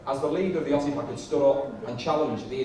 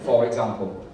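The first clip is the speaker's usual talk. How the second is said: normal talking voice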